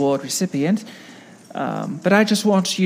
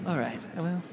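normal talking voice

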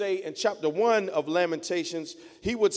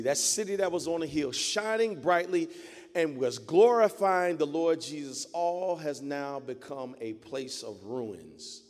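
ordinary delivery

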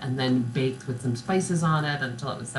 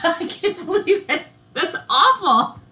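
laughing; 6 dB louder, slower than usual. Real, not acted. Laughing at a bad accent.

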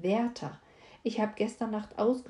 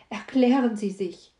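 louder, urgent demand; calling out; 3 semitones higher, 9 dB louder than usual. Acted. Werther urgently begging the weeping Fräulein, loud call. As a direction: cry out for her to explain. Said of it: "rief ich".

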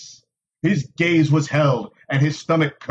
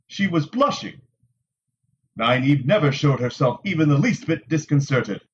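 plain reading voice

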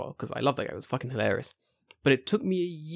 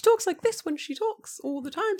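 squeaky-high teacher voice; 3 dB quieter than usual. Retold or imitated. Imitating his old elementary school teacher, very high voice. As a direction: imitate a very high-pitched little voice. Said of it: "Her voice, she talks".

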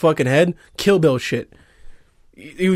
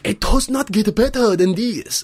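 higher, satisfied put-on voice; best impersonation; 6 semitones higher than usual. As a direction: play a blissed-out villager. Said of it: "that's my best impersonation".

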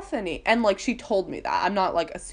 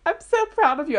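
high, loud imitation of proud crying; mock tearful pride; 9 semitones higher, 5 dB louder than usual. Bethany crying over Penelope's pointe shoes, mock tearful pride. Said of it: "like, crying, she's like".

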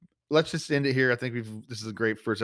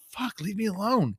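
mock celebrity snap; 11 semitones higher, slower than usual. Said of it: "you're gonna be like".

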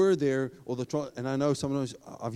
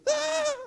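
mock scream. You're still screaming.

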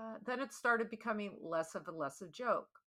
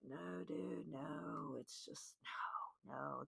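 dropping to a near whisper, shaking off the idea; hushed refusal; 4 semitones lower, 10 dB quieter, slower than usual. Retold or imitated. To her boyfriend about the litter box, a soft near-whispered no. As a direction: breathe out a soft, stunned no.

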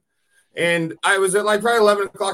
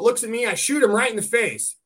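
usual speaking voice

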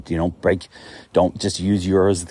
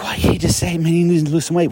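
higher, pleading why can't you just say it; exasperated plea; 9 semitones higher than usual. Retold or imitated. Speaker begging brokers for honesty, exasperated and higher. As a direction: throw up your hands at the broker. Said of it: "But I'm like".

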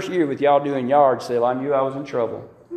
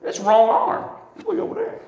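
high, comic mock scolding of the arm. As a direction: tell off the pain playfully, pitched up. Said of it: "I looked, I said".

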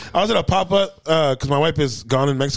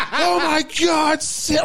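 high, slow, shouted squeal; a kid who can't contain himself; losing it; 11 semitones higher, slower than usual. Retold or imitated. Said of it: "just loses his shit".

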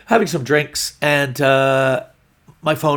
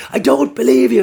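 much higher, louder mock yell; angry girlfriend; 12 semitones higher, 7 dB louder than usual. Girlfriend refusing boyfriend's explanation, furious mock shout. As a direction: shout the disbelief, imitating her rage. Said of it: "she's so angry though".

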